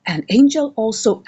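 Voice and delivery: normal talking voice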